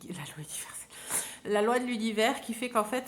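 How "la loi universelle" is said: softly whispered echo; whispering. Shown as a demonstration. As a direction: whisper the phrase back to yourself. Repeats phrase, then whispers it.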